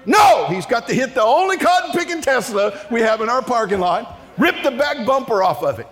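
high, loud comic rant; exasperated outrage. Real, not acted. Preacher on the driver hitting the Tesla, exasperated shout. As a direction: explode on the first word, keep ranting high.

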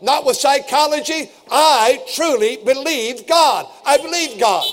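rising toward the climax, driving each "I believe"; impassioned preacher declaring his faith; preaching at full force. Acted. Closing crescendo of the sermon.

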